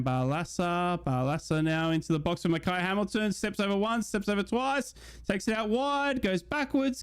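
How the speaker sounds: playful live commentary; mock play-by-play. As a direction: call it like a TV commentator.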